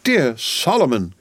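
mild hammed-up cry of joy. A narrator's reading. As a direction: call his name with emotion. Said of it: "cried Miss Pross".

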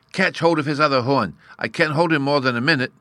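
put-on high, loud, urgent child's plea; wildly excited. A narrator's reading. Bruno struggling with a great snail, wildly excited. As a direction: cry out for help mid-struggle. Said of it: "in a state of the wildest excitement".

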